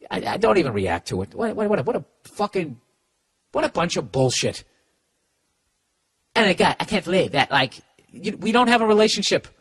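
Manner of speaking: ordinary talk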